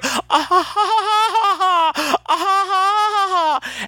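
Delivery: long, very high put-on society laugh; mock a flattered friend's showy, pretty laugh; affected high laugh. Imitating friend showing off his laugh in Act Two, affected. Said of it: "laughing like that".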